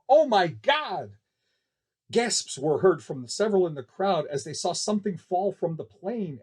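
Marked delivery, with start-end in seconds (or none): 0.1-1.2 higher, slow gasp of horror; shocked cry. Acted. Spectator seeing something fall from the plane, shocked cry. Crowd member sees the fall.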